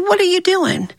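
squeaky high question. Acted. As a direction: ask it way up high. Hoppy asks, very high.

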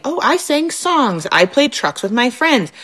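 put-on high, slow little-kid voice; toddler voice. Mom voicing her toddler's answer, high and slow. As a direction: lilt it like a proud two-year-old. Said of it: "My two-year-old from the daycare".

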